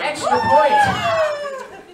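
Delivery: very high, loud, drawn out slowly; gleeful bonus. Acted. Host rewarding a dancer, high slow loud call. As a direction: stretch it out like a prize reveal.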